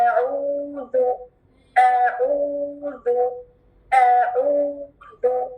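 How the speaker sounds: अऊज़ु drawn out slowly, clear ain at the start; careful recitation. Shown as a demonstration. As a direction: stretch अऊज़ु long with the throat sound. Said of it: "अब गौर से सुने". (अऊज़ु धीरे और साफ़ तिलावत)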